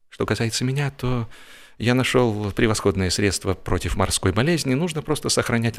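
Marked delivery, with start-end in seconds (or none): none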